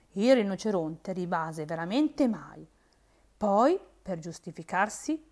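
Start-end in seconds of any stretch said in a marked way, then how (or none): none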